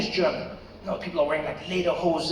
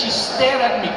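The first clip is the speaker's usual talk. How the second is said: ordinary delivery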